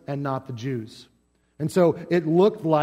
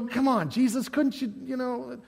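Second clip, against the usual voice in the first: mock exasperation; 7 semitones higher, 5 dB quieter than usual. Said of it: "we look at this and we go".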